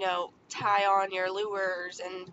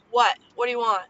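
curt, irritated what do you want; father brushing off his child, curt and irritated; impatient dad; 8 dB louder, faster than usual. Retold or imitated. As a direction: snap it at the kid like a busy dad. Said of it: "it's not an inviting tone".

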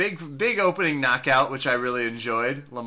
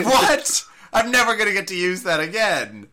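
much higher, louder shocked squawk; hammed-up shocked whine; mock dismay; 7 semitones higher, 6 dB louder than usual. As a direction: play Chael's outrage at losing his poem. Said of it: "He's like".

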